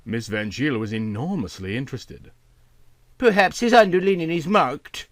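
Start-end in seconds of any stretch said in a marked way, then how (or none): 3.2-5.1 put-on higher, brisk old aunt voice; aunt helpfully suggests, higher voice; aunt suggesting. A narrator's reading. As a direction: offer it helpfully. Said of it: "she suggested".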